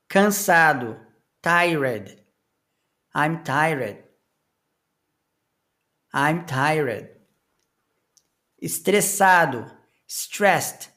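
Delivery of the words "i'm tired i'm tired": ordinary talk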